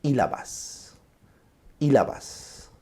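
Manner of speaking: demonstrating logoclonia for the class; echoed syllable. Shown as a demonstration. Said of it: "¿ves? Eso es una logoclonía".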